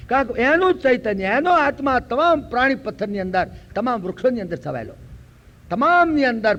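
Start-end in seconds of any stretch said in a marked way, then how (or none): none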